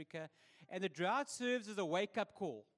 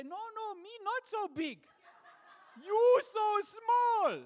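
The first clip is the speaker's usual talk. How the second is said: much higher, ending in a loud slow laughing shout; mock broken-English reply; 12 semitones higher, 9 dB louder, slower than usual. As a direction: tease them back in their own broken English. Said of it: "didn't have the heart to say".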